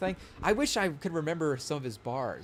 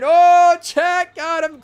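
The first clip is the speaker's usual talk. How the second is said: loud, high hype shout; hyped reaction; 13 semitones higher, 16 dB louder, much slower than usual. Real, not acted. Streamer hyping his own bar to chat, loud excited shout.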